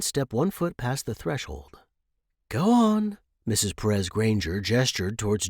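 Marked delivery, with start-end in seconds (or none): 2.5-3.2 bright upbeat urging; encouraging. A narrator's reading. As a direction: wave them on eagerly. Encouraging the hatchlings.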